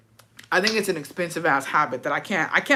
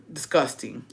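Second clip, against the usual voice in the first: ordinary talk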